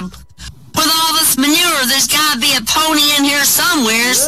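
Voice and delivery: put-on a kid's high hopeful voice; little boy voice. Little boy's hopeful line in joke.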